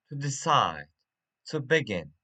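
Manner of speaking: "decide" and "begin" with the second syllable stressed; "to decide, to begin" with weak first syllables, strong last ones. Shown as a demonstration. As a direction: lean on the end of "decide" and "begin". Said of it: "stress on last syllable".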